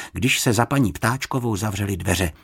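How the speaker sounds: ordinary delivery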